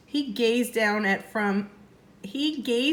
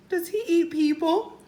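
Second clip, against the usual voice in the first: 1 whispered; 8 semitones higher than usual. Acted. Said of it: "whispered Annie".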